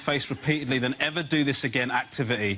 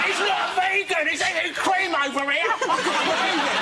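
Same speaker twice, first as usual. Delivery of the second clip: much higher mock outrage; imitating Scott; 12 semitones higher than usual. Scott exposing the vegan eating cream, much higher outrage. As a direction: tattle on him in mock outrage. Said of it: "And Scott's going".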